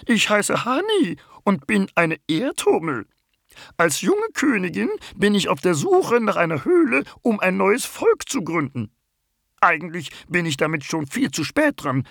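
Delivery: very high bumblebee voice, a little sleepy; the queen bumblebee introduces herself; young queen bumblebee. Acted. (Hummelkönigin mit sehr hoher Stimme, etwas verschlafen)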